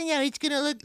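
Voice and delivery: imitated mother brushing off protest; Diane. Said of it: "She'd be like".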